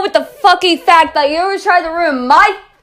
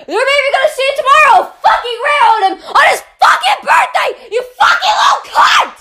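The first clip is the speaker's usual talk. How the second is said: high-pitched, shouted fury; furious ranting; 6 semitones higher than usual. Real, not acted. Ranter raging over a brother's ruined birthday, furious shouting. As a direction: build to a screamed insult.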